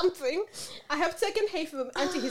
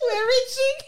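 high loud laughing wail; 12 semitones higher, 7 dB louder, slower than usual. Real, not acted. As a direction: cry it out laughing. Laughing cry reaction.

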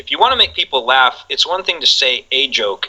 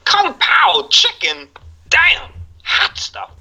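very slow, much higher, laughing through it; Walken impression; 11 semitones higher, much slower than usual. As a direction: Walken savouring the dish name. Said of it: "Just hearing him say".

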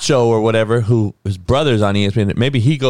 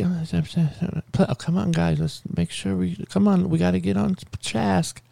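higher, softer mock of a weak host voice; a hammed-up timid host, higher and softer; 8 semitones higher, 6 dB quieter than usual. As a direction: imitate a timid host pleading with the panel. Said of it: "allow her voice to project a little".

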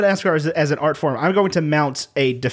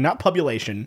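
usual speaking voice

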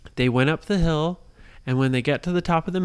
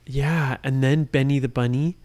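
ordinary delivery, nothing marked

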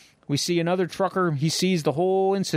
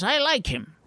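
puppy dog voice; 7 semitones higher than usual. Acted. Puppy dog character speaks.

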